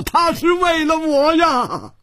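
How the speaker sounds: anguished high-pitched cry; desperate strained yelling; hoarse shouting. A narrator's reading. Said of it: "嘶着声音喊道".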